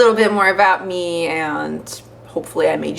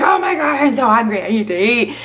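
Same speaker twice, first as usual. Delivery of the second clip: hungry outburst; 3 semitones higher than usual. Retold or imitated. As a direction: blurt it out as if suddenly starving. Suddenly starving, dramatic outburst.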